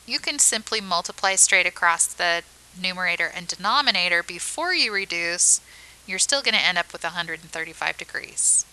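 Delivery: normal talking voice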